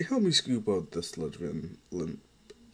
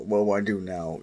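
ordinary delivery

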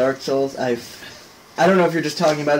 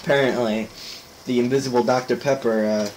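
ordinary talk, nothing marked

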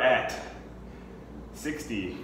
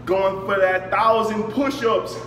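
pumped up shout; 7 semitones higher, slower than usual. Real, not acted. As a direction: fire yourself up. Hyping himself after set.

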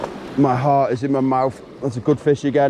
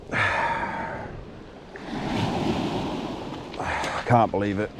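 lower, much softer, deflated; gutted; 6 semitones lower, 7 dB quieter than usual. Real, not acted. Angler after losing the fish, quietly crushed. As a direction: barely get the words out after the loss.

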